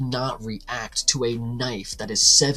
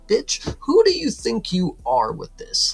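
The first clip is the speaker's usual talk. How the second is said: high, slow, indignant outburst; mock outrage; 8 semitones higher, 4 dB louder, slower than usual. Podcaster talking back to the pushy bar owner, indignant. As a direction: tell her off, stretched out and pitched way up.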